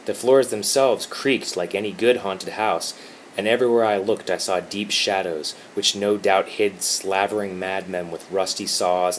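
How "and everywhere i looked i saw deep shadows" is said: plain reading voice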